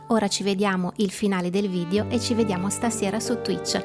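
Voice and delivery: normal talking voice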